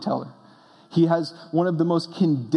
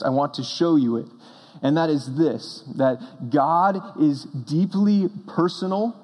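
normal talking voice

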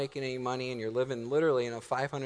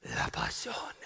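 put-on low, hushed foreign-accented whisper; dramatic whisper; 4 dB quieter, slower than usual. Husband's second mock speech to wife ends, whispered. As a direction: whisper the answer like a secret revelation.

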